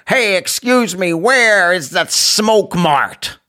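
slow put-on American accent; lightly done American accent. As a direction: imitate an American tourist slowly. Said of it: "she was American".

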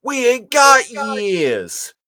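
drawled high cartoon rat line; Rat Trap. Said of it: "And Rat Trap's like".

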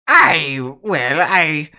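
lightly voiced cheery sea dog; hearty sailor greeting a visitor; jolly old shipwright.

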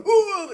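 disgusted mock gagging noise; drawn-out "ugh" of revulsion; retold gag. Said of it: "you know, I gagged".

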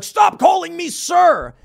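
high, loud, slow mocking shriek; mock shouting customer. Host mimics the furious customer, high mock yell.

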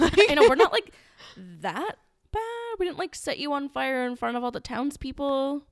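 high-pitched mock protest; sarcastic. Defending witch hunters to their victims, sarcastic. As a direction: drag out "that bad" in sarcastic defence. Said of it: "Sarcasm, my God".

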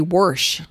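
"worsh" with an r sneaking in; worsh for wash. Retold or imitated. Imitating people who say "worsh" instead of wash. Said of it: "people that say".

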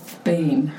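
usual speaking voice

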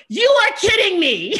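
high, loud, laughing squeal; giddy shriek of joy; squealing disbelief. Retold or imitated. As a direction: squeal through a laugh, unable to believe it. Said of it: "And I was like".

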